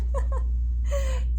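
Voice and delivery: high breathy laugh. Real, not acted. Laughs about countdown.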